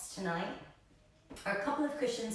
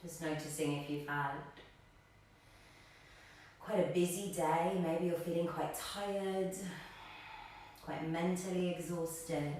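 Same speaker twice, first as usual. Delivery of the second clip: calm, slightly lowered meditation voice with long pauses; guided meditation; 3 semitones lower than usual. Real, not acted. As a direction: guide them gently into stillness, leaving space between phrases. Guides seated breathing meditation.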